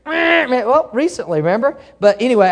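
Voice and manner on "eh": mock horn honk. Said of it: "all of a sudden I hear".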